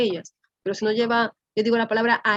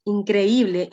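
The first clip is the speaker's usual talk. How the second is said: usual speaking voice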